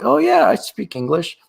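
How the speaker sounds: high, lightly mocking "Oh yeah"; playful impression of a snooty local, pitched high; mock Parisian. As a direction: mimic a Parisian suddenly switching to English. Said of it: "all of a sudden they're like".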